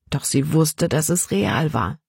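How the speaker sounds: ordinary delivery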